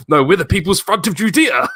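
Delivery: hammed-up indignant correction; indignant film character. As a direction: correct them with wounded pride. Quoting film dialogue.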